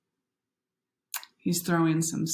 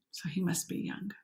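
soft aside in a whisper; confiding whisper; 7 dB quieter than usual. Real, not acted. Narrator whispering an aside to the viewers. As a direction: let them in on a secret.

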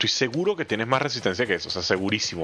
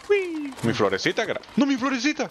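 cooing; 14 semitones higher than usual. Pretend. Player cooing at a flower he found, high baby talk. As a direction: coo over the little flower.

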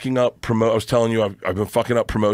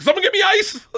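very high, louder frantic plea; hammed-up panic, pitched way up; mock desperation; 3 dB louder than usual. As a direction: call for ice like an emergency.

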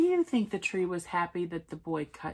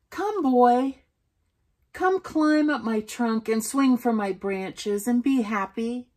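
loud, higher, delighted invitation; joyful; 5 semitones higher, 10 dB louder than usual. A narrator's reading. Tree welcomes the boy back to play, joyful. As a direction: call out with joy to a returning friend. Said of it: "the tree shook with joy".

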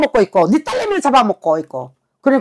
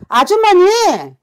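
sharp call out; 7 semitones higher, 4 dB louder, much slower than usual. Real, not acted. Calls out to silent client.

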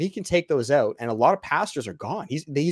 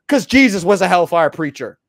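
loud, emphatic proclamation; forceful raised-voice conviction; hellfire preaching; 5 semitones higher, 9 dB louder than usual. Real, not acted. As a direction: declare it like a preacher at full force. Proudly owns the hellfire preacher label.

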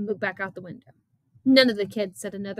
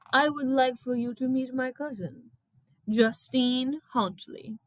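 raised pitch, deliberate introduction; Missus Jeepers' character voice; 4 semitones higher, slower than usual. A narrator's reading. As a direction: present her formally, slowing on the name. Missus Jeepers introduces her cousin.

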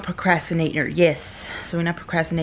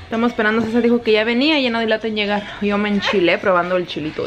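ordinary talk

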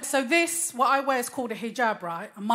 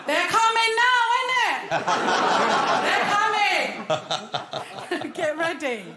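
laughing; 5 semitones higher than usual. Real, not acted. Comic teasing North Africans in the crowd, laughing. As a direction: giggle the words out.

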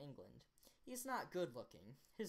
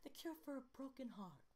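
high, softer British woman; put-on British accent, pitched up and lighter; British-accented mother; 9 semitones higher, 5 dB quieter than usual. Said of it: "keeping British".

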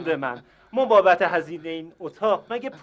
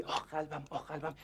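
a hushed, mocking imitation of the old man groaning about his heart; the hotel manager mimics the guest's fake heart complaints, hushed and mocking; mock heart-attack moaning; 3 semitones lower, 14 dB quieter than usual. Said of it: "هی میگی".

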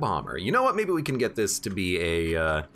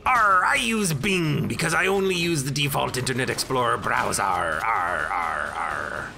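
pirate voice. Acted. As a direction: play a goofy pirate. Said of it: "what search engine does a pirate use?".

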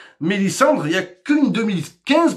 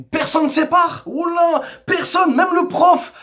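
higher, shouted insistence; emphatic shout; 8 semitones higher than usual. Real, not acted. Emphatic rant, shout heard. (crié, plus aigu)

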